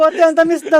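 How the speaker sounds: high, laughing report; laughing while relaying. Real, not acted. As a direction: giggle through the words.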